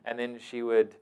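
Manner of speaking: ordinary delivery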